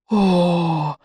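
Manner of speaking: long drawn-out 'oh' of wonder; superstitious awe; awed gasp. A narrator's reading. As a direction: breathe out in amazement like a crowd. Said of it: "хором выдохнули они".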